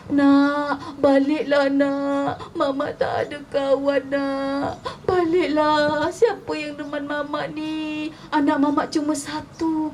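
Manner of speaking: high, drawn-out tearful wail; mother weeping. A narrator's reading. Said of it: "Kemudian mamak dia nangis". (ibu meratap sambil menangis)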